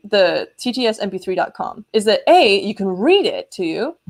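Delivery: ordinary talk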